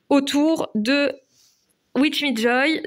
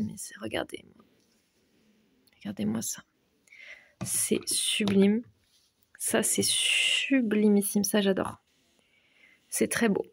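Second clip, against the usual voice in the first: hushed admiration; 6 dB quieter than usual. Real, not acted. Hushed admiring of precious stones.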